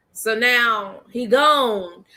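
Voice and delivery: ordinary talk, nothing marked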